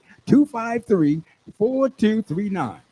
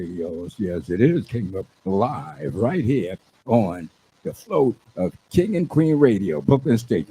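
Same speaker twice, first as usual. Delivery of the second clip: radio voice; 9 semitones lower than usual. Acted. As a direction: announce it live, deep and stretched. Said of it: "I'm gonna put their radio voice up in there".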